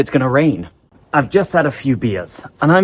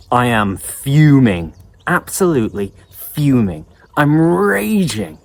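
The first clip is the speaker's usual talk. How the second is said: acted fuming. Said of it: "That was pretty good acting".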